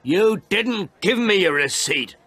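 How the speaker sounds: slow, high, loud protest; indignant, drawn-out yell; angry shout. A narrator's reading. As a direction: shout each word in outrage. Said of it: "I shouted".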